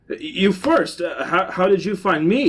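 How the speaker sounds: acted suspicion, pointed and insistent; accusing. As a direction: demand answers from an intruder. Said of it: "he said accusingly".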